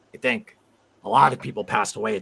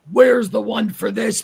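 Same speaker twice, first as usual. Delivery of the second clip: imitating an indignant commenter, much higher and louder; hammed-up outraged demand; mock demanding fan; 11 semitones higher, 8 dB louder, slower than usual. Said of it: "and they'd be like".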